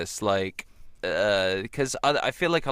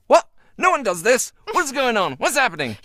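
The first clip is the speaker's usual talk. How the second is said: high, loud bewildered questions; flabbergasted at the kindness; much higher, louder, baffled; stunned disbelief; 12 semitones higher, 6 dB louder than usual. Retold or imitated. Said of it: "And I was just like".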